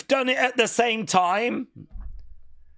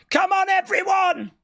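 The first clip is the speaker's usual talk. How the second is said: rallying shout; 13 semitones higher than usual. Retold or imitated. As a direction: rouse the crowd. Mimicking wrestler rallying crowd.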